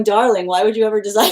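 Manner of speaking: quick, laughing mock-exasperated guide voice; amused guide. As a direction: play the spirit guide tutting through a laugh. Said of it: "They're going".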